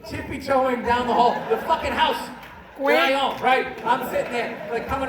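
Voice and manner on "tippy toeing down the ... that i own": normal talking voice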